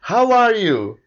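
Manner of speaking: lightly voiced English accent; expected British greeting, lightly mocked. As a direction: say it like a polite Brit. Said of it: "esperando aqui um vir".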